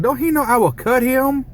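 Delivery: high-pitched girlfriend impression; imitating girlfriend. Girlfriend reacting to his video, high mock threat. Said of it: "She'll be like".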